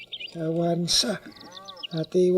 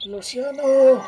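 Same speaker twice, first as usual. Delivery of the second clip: calling out; 6 semitones higher than usual. Acted. Old man calling out to Luciano. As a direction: call his name out to reach him.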